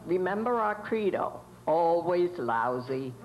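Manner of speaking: deep slow slogan. Acted. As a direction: drop low and slow on always lousy. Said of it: "nineteen twenties radio station studio".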